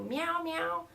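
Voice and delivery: high imitation meows; cat meow. Yogi showing student cat sounds, high meowing.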